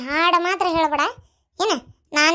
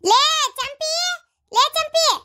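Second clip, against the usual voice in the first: calling out; 10 semitones higher, much slower than usual. Acted. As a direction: shout for the daughter-in-law to come. Mother-in-law calling daughter-in-law.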